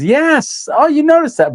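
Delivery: light up at the compliment; pleased surprise. Real, not acted. Answering a viewer who spotted the matching necklaces, with delighted surprise.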